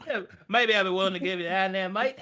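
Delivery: grudging help with a mate at the end; quick hammed-up Australian; Aussie NPC.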